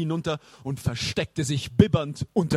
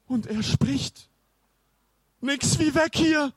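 high-pitched frightened cry; panicked alarm; 12 semitones higher, 4 dB louder than usual. Acted. Boy hears the mummy speak and wants away, high panic. Boys flee from talking mummy.

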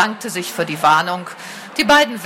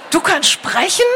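much higher, stunned question; astonished; 10 semitones higher than usual. A narrator's reading. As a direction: gasp it out in disbelief. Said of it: "Aluins erstaunten Tonfall". (erstaunt, ungläubig)

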